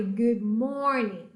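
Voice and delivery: "Good morning" with a strong, exaggerated English R in morning. Shown as a demonstration. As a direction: stretch the R in "morning" slowly. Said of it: "O R em inglês é bem exagerado". (R bem exagerado em "morning")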